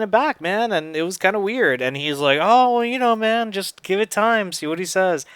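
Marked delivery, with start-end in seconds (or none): 2.4-5.3 reassuring dad. Retold or imitated. Voices dad reassuring him.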